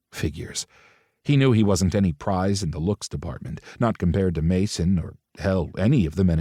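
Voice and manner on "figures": soft, whispered resignation; resigned sigh. A narrator's reading. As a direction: sigh it under your breath.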